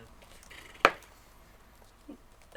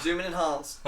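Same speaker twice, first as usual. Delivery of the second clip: mock excited; 6 semitones higher, 8 dB louder than usual. Movie cliché call.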